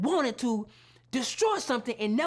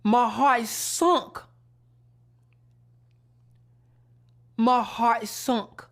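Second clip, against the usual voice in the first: slow, louder, weighted; heavy grief; 6 dB louder, much slower than usual. Real, not acted. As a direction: let each word land heavy. Grief at the loss.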